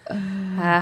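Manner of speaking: ordinary talk, nothing marked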